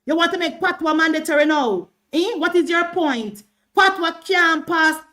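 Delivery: ordinary talk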